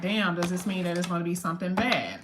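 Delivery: ordinary talk, nothing marked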